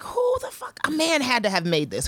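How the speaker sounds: high-pitched, scandalized disbelief; retold incredulous outrage. Buyer appalled by the product she bought, incredulous. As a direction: start way up high in disbelief, then come down. Retelling her reaction to a bad product.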